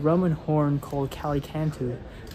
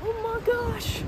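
surprised; 4 dB quieter than usual. Real, not acted. Relief at finding lost group.

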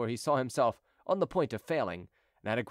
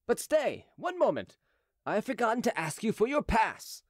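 high, sudden calling back; crying out; 9 semitones higher than usual. A narrator's reading. Officer stopping Chicot at the gate, crying out high. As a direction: stop them with a high-pitched exclamation. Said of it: "cried he".

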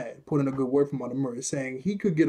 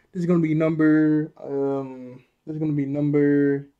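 ordinary delivery

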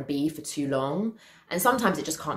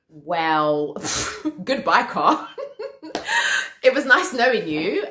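mock farewell through laughter, higher and louder; laughing resignation; 5 semitones higher, 4 dB louder, slower than usual. Owner bidding her car goodbye, laughing in mock resignation. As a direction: laugh it off as a lost cause.

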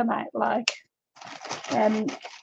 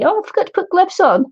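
high sudden oh of surprise; surprised; 8 semitones higher, 3 dB louder than usual. Real, not acted. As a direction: blurt it out, just realising. Sudden realisation.

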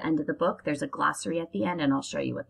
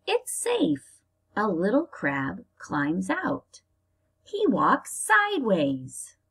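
slow, lively storybook reading; reading to children; 2 semitones higher, slower than usual. Acted. Teacher reading a crab book to her class, storybook style. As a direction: read it out to little kids, drawing it out.

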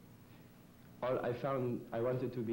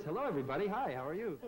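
much higher-pitched, cheery greeting; switched character voice; 6 semitones higher, faster than usual. Shown as a demonstration. Demonstrating an accent switch to the hosts, high character voice. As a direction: greet everyone in a raised character voice. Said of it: "Then I have this".

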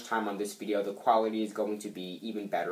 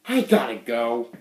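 louder, overdone exit line; too much; 5 dB louder than usual. Acted. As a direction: blurt it out, overacted. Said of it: "that was too much".

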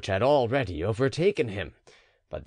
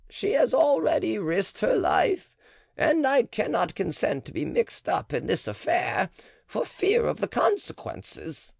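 a touch higher, guarded matron; lightly voiced cautious midwife; 9 semitones higher than usual. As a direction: answer warily, keeping your distance.

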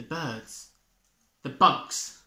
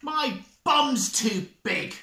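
mild bellow; 8 semitones higher, 4 dB louder, slower than usual. Acted. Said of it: "bellowed Bear".

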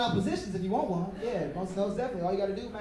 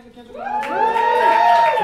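calling out; 12 dB louder, slower than usual. Real, not acted. Comic asks the crowd, a stretched high shout.

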